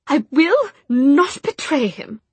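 high, loud, slow defiance; stubborn refusal. Acted. Jessamine refusing.

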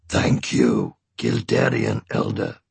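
very low dragon's voice. A narrator's reading. Saphira thanking the elder for leave to pass, deep dragon voice.

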